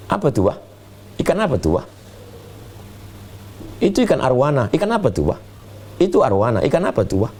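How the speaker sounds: put-on light high child's voice, pestering questions; mock curious child. Preacher imitates his child asking dad about fish, lightly high. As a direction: playfully imitate a small child asking over and over.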